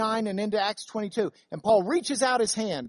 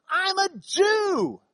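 slow, high emphatic shout; 13 semitones higher, 4 dB louder, much slower than usual. Acted. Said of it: "It's like he's shouting it".

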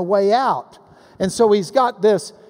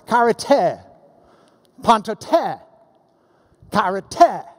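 "Kairete, pantote, kairete" with the final "te" brought out; "Kairete, pantote, kairete", paused between words, endings stressed; slower than usual. Shown as a demonstration. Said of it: "He's got that ending sound".

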